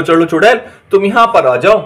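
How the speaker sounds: ordinary talk, nothing marked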